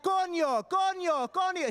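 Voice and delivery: high-pitched comic shouting; insistent, very high repeated cry. Acted. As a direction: yell each word like talking to someone who doesn't understand. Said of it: "say it louder and louder".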